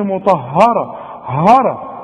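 "مطهره" then "هره" with dark "haw"; wrong heavy ha. Retold or imitated. Teacher challenging a student's mistake, heavy ha. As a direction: exaggerate the thick ha in "هره". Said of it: "بتفخمها لي ليه هنا؟".

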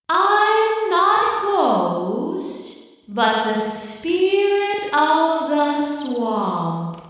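spirit voice. Acted. Swamp spirit reveals herself.

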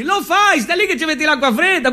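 usual speaking voice

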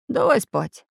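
mildly drowsy mumbled plea; a touch of sleepy grumpiness; sleepy grumble. A narrator's reading. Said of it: "сонно пробурчал Самоделкин".

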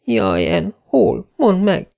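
put-on high anxious girl voice; Carrie tearful. A narrator's reading. Carrie begging Anne to answer, high and tearful. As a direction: plead for an answer. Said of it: "zokogta Carrie Sloane".

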